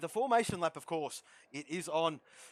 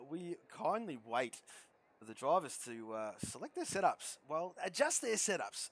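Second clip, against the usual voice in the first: ordinary delivery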